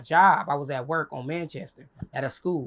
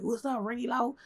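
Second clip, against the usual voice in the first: imitating admirer; 5 semitones higher, 4 dB quieter, slower than usual. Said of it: "He's like".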